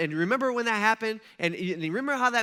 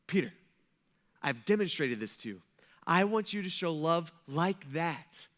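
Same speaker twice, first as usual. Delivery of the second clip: ordinary talk, nothing marked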